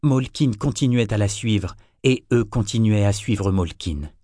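ordinary delivery

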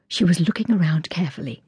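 usual speaking voice

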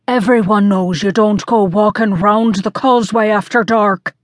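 lightly voiced Coast Guard officer; a hint of an officer's voice, admonishing. A narrator's reading. Officer chiding late-night walkers while taking their report, lightly voiced Coast Guard officer. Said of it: "said the Coast Guard officer".

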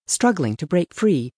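plain reading voice

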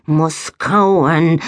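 simple-minded creature grunt; blunt, single-minded animal; beaver-cat voice. A narrator's reading. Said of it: "dachte Biberkatzen Nori".

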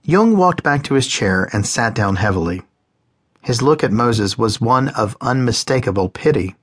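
usual speaking voice

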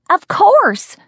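very high, loud theatrical exclamation; brazen exclamation. A narrator's reading. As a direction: toss it out high and loud, shamelessly. Tipsy woman's brazen admission.

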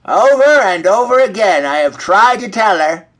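fed-up storybook hen; exasperated hen. A narrator's reading.

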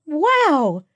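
high-pitched, amazed exclamation. Acted. Friend reacts to surprising decision.

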